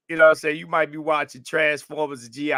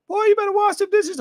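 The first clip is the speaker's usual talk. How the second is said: high, loud mock yell of a mom; a mother hollering at her son to do the dishes; 5 dB louder than usual. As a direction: bark it like a mom calling from the next room. Mom scolding the boy.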